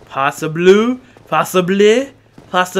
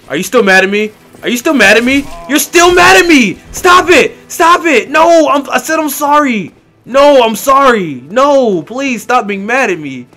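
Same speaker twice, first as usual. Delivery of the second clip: high, loud panicked shouting; panicked yelling; 9 semitones higher, 9 dB louder than usual. Real, not acted. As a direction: yell in panic to make it stop. Character attacks again, he pleads.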